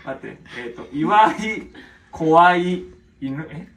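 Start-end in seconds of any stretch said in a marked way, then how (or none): 0.9-3.5 very slow, loud reading; sounding out words. Real, not acted. Retrying the puzzling story line, loud and drawn-out. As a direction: stretch each word out loudly as you decipher it.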